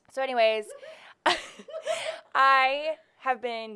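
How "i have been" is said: laughing slowly. Real, not acted. Starts her love story, laughing.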